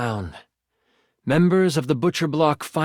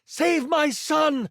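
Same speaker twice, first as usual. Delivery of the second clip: yell; 13 semitones higher, slower than usual. Acted. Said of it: "she yells".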